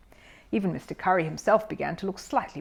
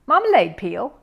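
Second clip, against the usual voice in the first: sharp disbelieving question; incredulous echo; 4 semitones higher, 7 dB louder than usual. A narrator's reading. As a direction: repeat it in disbelief. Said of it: "echoed Mister Curry".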